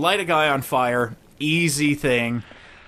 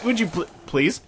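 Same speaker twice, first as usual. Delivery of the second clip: ordinary delivery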